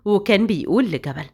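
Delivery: usual speaking voice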